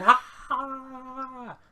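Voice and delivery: high, loud mock scream of a teenage girl; hammed-up girl's scream. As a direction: shriek like a panicked girl. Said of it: "screams of a teenage girl going".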